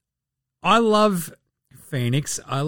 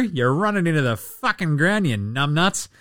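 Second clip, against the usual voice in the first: usual speaking voice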